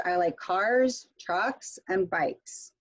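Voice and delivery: "cars" and "trucks" go up, "bikes" comes down; list intonation. Shown as a demonstration. Teacher repeating the list example, rising then falling intonation. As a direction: lift each item, let "bikes" drop. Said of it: "in the list".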